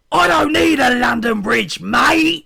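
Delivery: very loud, high-pitched full-throated yell; big drawn-out shout ending on MATE; shouted wrestler. Acted. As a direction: holler it like a wrestler cutting a promo. Acts Luke's loud wrestler promo.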